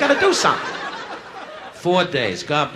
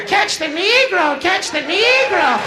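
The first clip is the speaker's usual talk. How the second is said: much higher, slow, swooping camp voice; camp shriek; 5 dB louder, much slower than usual. Acted. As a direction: drag each word out high and campy. Queer character voiced in joke.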